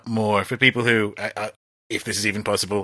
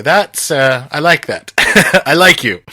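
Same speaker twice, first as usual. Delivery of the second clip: higher and louder, laughing as he says it; amused approval; 5 semitones higher, 9 dB louder than usual. Retold or imitated. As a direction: cheer the defiant sailor on, laughing. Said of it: "You go".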